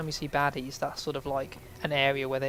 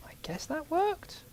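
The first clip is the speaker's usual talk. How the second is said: whispered doubt; 13 semitones higher, 3 dB quieter, slower than usual. Real, not acted. Unsure after explosion.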